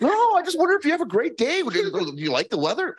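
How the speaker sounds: high, loud chirpy friendliness; high-pitched mock Midwest nice; sunny Midwest nice. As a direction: gush like an overly friendly Midwesterner. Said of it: "Midwest nice thing".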